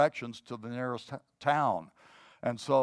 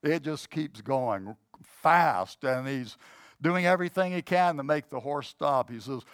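usual speaking voice

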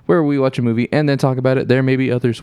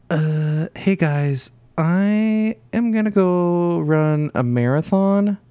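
slow, drawn-out imitation of an absent co-host; mock a friend, dragging the words out slowly; Sid; 5 semitones higher, much slower than usual. Host imitates the absent co-host bailing on them, slow mocking voice. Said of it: "that wasn't a good Sid voice".

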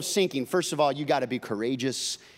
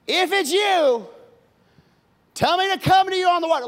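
higher, louder, a challenging demand; brash Peter; 8 dB louder than usual. Acted. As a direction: dare Jesus to prove it. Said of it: "Peter says".

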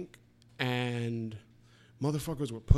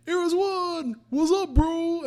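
much higher, louder mock greeting; hammed-up high bro hello; imitating Joe; 6 dB louder, slower than usual. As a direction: put on a squeaky, excited bro voice. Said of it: "and he just goes".

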